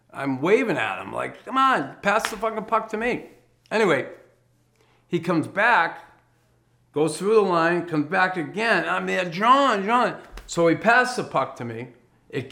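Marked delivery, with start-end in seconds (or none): 1.4-3.3 high, pushy call for the puck; exasperated teammate calling out; impatient call. Retold or imitated. Waving, demanding the puck. 9.2-10.2 high, loud call across the ice; yelling for the puck. Retold or imitated. Calling again for the puck.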